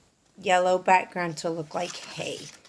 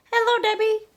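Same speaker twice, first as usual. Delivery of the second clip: high, bright little hen voice; Dora the hen; 14 semitones higher, 5 dB louder, faster than usual. Acted. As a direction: play a chirpy young hen wondering aloud. She called.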